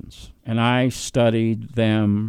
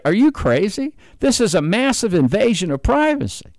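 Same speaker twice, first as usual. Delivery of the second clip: mock founding father, pitched higher, aghast; incredulous founding fathers; 8 semitones higher than usual. Speaker imagining the founding fathers scolding taxpayers, higher and incredulous. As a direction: play the outraged founding fathers, voice lifted high. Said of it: "The founding fathers would say".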